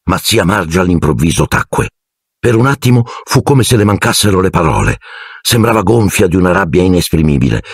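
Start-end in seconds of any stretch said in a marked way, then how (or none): none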